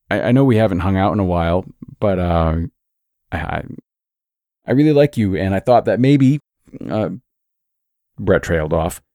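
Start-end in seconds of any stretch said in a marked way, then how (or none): 0.1-7.1 stammering. A narrator's reading. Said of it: "he stammered".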